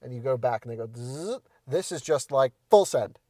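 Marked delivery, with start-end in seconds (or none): 1.0-1.4 rising "zzz" of a seat motor; motor ramp-up buzz. Retold or imitated. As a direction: imitate a motor smoothly gaining speed. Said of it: "they ramp up to speed".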